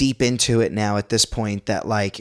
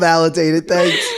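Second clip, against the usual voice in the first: ordinary talk, nothing marked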